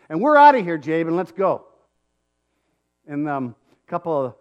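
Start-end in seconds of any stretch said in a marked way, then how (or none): 0.1-1.6 loud, sharp call to leave; angry exit. Retold or imitated. Dad pulling his son out of the carnival, angry. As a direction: snap at him to get out now.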